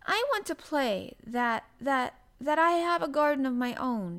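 high, loud, halting stammer; nervous girl forcing out her answer. A narrator's reading. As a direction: hesitate and restart, almost afraid to answer. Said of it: "she stammered".

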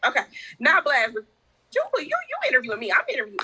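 high playful teasing; playful excited. Real, not acted. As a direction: tease them, excited and bright. Teasing host, pitch up.

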